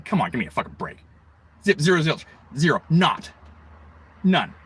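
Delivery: ordinary talk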